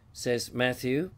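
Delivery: usual speaking voice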